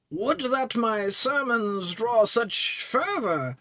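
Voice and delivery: high, slow voice of an aging cleric; lightly voiced old priest musing; old priest NPC. Player voicing the priest to the thief, high and slow.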